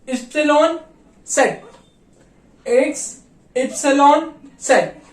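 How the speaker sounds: letters called out one by one; German alphabet recital. Shown as a demonstration. Teacher going over remaining German letters aloud. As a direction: read each letter clearly. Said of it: "अगला आता है पे".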